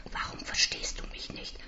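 tonloses Flüstern; flüstere verzweifelt fragend; geflüstert. Acted. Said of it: "frage ich". (flüsternde Frage)

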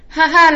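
a loud, bright ha-ha; mocking laugh at the idea; prince's laugh. A narrator's reading. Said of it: "lachte der Prinz".

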